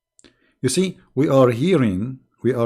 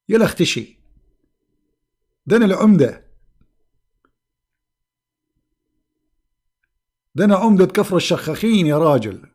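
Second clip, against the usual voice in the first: higher and louder, mock outraged; indignant retort; 4 semitones higher, 3 dB louder, much faster than usual. Prophet scolding the greeter, mock outraged and loud. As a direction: puff up like an offended village mayor. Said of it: "So he said".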